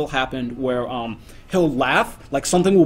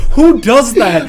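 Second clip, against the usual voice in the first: loud, high, drawn-out disbelief; slow incredulous question, half laughing; mock incredulity; 5 semitones higher, 4 dB louder, slower than usual. Retelling friends reacting to the odd laugh, incredulous. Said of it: "and we're like".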